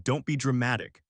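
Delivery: ordinary delivery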